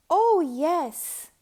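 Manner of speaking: loud, high eager cry; cried out. A narrator's reading. Kids eagerly answering their teacher, loud and happy. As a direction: shout it with delight. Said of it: "cried the kids".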